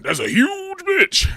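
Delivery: drawn-out, high, delighted gush; excited exclaim. Real, not acted. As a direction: stretch it out in delight. Gushing over the outfit.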